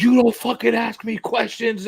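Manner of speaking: high, slow imitation of the artist snapping at him; hammed-up snap, slow and high; mock angry artist. Imitating the artist who turned on a fan, slow mock snap. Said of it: "He's like".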